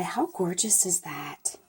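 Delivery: breathy hushed admiration; awed whisper. Real, not acted. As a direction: whisper it as if marvelling at something delicate. Admiring a stamped butterfly.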